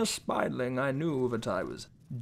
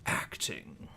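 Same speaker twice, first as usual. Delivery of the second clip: whispered; 12 semitones lower, 9 dB quieter than usual. Acted. Dreamy whispered word.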